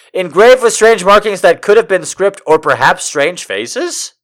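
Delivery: high, loud mocking recital; mock re-reading. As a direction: repeat it in an exaggerated high voice. Mocking re-read of the line.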